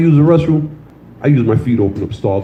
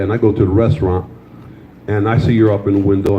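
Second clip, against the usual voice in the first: ordinary delivery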